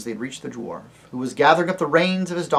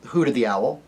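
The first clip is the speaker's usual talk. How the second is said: ordinary delivery, nothing marked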